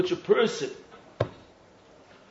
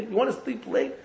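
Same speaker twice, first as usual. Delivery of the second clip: ordinary talk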